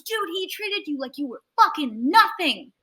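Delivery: high, emphatic retold outrage; indignant friend. Assistant's indignant outburst, retold.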